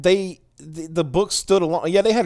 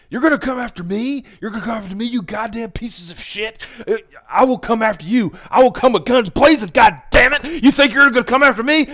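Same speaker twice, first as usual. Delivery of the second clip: much higher, louder ranting impression; Alex Jones rant; 10 semitones higher, 5 dB louder than usual. Host shows how to do the radio host's rant properly, furious. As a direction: build from sneering challenge to full-blown furious yell. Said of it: "you gotta do it right. Here's how you do it".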